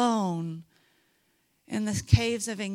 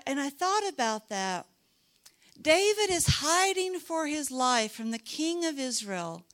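ordinary talk, nothing marked

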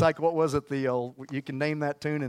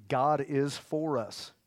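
slow declaration; much slower than usual. Acted. Drawn-out declaration.

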